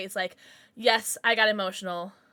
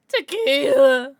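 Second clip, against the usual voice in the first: loud, stretched-out cheer; slow, loud party call; cheering tequila; 2 semitones higher, 11 dB louder, much slower than usual. Real, not acted. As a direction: drag the word out like a party chant. Cheering the chat's drink vote.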